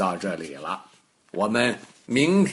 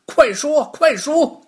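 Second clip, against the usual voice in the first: much higher, louder yelled order; shouting; 8 dB louder than usual. Acted. Guards beating the stone shout at it, high loud yelling. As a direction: guards bellowing as they beat the stone. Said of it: "一边打一边喊".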